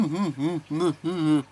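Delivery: high muffled hums through a mask; muffled humming. Shown as a demonstration. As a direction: hum like mumbling through a mask. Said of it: "if it's all".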